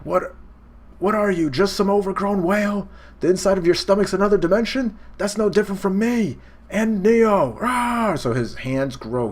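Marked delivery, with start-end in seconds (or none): 0.0-8.2 high and fast, building to a roar; crazed boasting. Retold or imitated. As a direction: rant with godlike arrogance then roar. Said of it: "He's going crazy".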